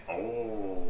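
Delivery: theatrical oh. Shown as a demonstration. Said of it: "You gotta say it like that".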